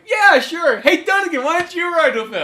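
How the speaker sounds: high, loud re-enacted eagerness; mock eager agreement. Re-enacting his breezy offer of help.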